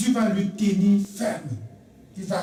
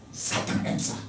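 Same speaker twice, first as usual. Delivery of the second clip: hushed aside; 6 dB quieter than usual. Acted. Preacher's refrain, hushed.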